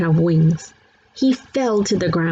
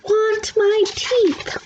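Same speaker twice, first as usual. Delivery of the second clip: much higher, very slow wailing; toddler scream; much slower than usual. A narrator's reading. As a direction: wail it like a tantrum. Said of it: "Fudge started to scream".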